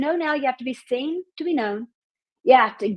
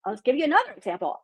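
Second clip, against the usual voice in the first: normal talking voice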